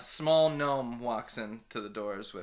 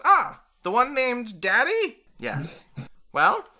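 higher, louder eager gnome; 4 semitones higher, 7 dB louder than usual. Acted. Dervish greeting the crew, eager gnome. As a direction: chirp it like a keen tinkerer.

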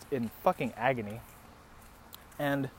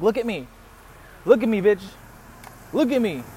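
raised, insistent retold command; acted firm demand; 6 semitones higher, 4 dB louder, faster than usual. Man shaking his date's shoulders, insistent acted demand. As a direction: demand attention, pitched up, with gaps between.

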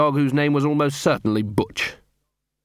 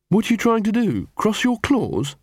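incredulous; 10 semitones higher than usual. Acted. Angua incredulous at Gaspode.

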